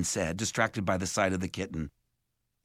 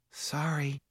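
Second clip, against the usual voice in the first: soft, higher, sheepish apology; much smaller voice; 5 semitones higher, 5 dB quieter than usual. A narrator's reading. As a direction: shrink the voice down small. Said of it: "in a much smaller voice".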